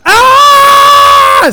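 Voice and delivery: sudden high yelp of surprise; a sharp startled cry; startled shout. Real, not acted.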